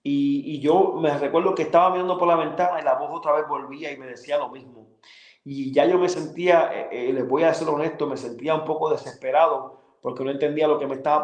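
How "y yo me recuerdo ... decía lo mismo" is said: ordinary delivery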